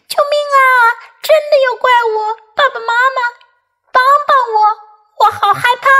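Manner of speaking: high, urgent cries for help; frightened shouting. Acted. Kipper calling his parents to save him, frightened shouting. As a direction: plead in a high, alarmed child voice. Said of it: "齐波大喊起来".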